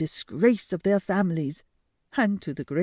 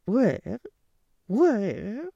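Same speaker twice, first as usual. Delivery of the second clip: cooing pigeons; 5 semitones higher, 4 dB louder than usual. Acted. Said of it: "cooed the pigeons".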